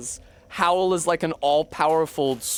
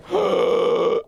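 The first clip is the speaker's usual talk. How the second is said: sharp gasp; 2 semitones higher, 4 dB louder than usual. Real, not acted. Reacting to game on screen.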